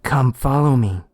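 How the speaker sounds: a hint of a luring call; eerie voice. A mysterious voice luring the detective, lightly eerie. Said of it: "said a voice".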